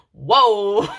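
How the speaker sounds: overwhelmed outburst; loud whoa. Real, not acted. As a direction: burst out with a big whoa. Sudden emotional outburst after reading.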